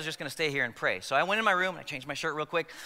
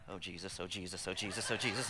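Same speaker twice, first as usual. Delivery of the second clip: much softer and lower, a frightened little murmur; scared prayer; 11 semitones lower, 12 dB quieter than usual. Retold or imitated. Elementary kid walking past the demon, scared whispered prayer. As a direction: mutter it nervously, half laughing.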